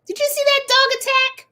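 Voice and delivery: loud, very high squeal of disbelief; mock outrage. Telling viewers about the dog attack, mock outrage. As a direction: play up the shock, high and loud. Feigned shock at dog's attack.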